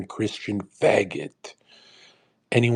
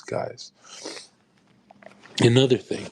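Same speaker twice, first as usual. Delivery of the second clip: usual speaking voice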